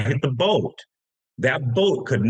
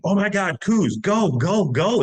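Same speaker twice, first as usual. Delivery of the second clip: retold scream; 9 semitones higher than usual. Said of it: "he just starts screaming".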